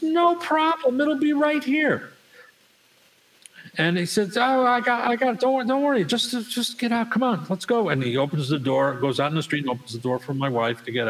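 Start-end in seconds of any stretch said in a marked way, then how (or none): 0.0-2.1 high, drawn-out put-on voice; high-voiced mock reassurance; eager valet impression. Valet answers in story. 4.4-7.9 high-pitched, stammering acted urging; mock valet brushing off worries; hurrying valet impression. Valet hurries them out in story.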